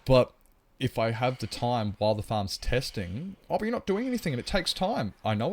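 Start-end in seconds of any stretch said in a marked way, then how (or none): 3.5-5.1 much higher, whiny mimicking tone; high mocking imitation of a critic. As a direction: parody the naysayer.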